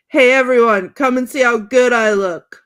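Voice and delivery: hammed-up holler for everyone's attention; acted calling out. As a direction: call out to the whole room, showing off. Acts Ron calling everyone over.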